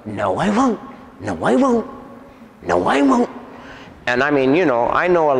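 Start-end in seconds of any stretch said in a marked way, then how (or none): 0.0-3.3 taunting refusal, pitch swooping high; defiant demon child. Retold or imitated. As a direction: imitate a bratty possessed kid refusing, rising high. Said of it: "And he was going".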